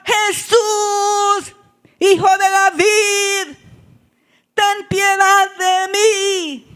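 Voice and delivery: high, loud cry with long-held vowels; acted blind man's full-voiced clamor; shouted plea. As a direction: shout desperately for mercy, drawing it out. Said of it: "Más gritaba".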